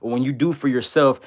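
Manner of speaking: normal talking voice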